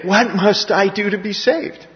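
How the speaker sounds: desperate plea. Retold or imitated.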